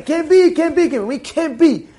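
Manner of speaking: high, insistent mock protest; retold screaming. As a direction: imitate a congregant screaming at the rabbi. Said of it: "screaming against the rabbi".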